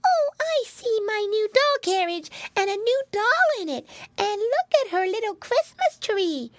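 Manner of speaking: put-on very high little-girl delight; squeaky baby piglet voice; Baby Pinky. A narrator's reading. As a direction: marvel like a tiny child at presents. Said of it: "cried Baby Pinky".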